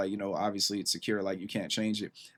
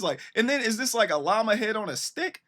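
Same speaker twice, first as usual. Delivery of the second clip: excited; 8 semitones higher than usual. Real, not acted. Listing the animals in the video.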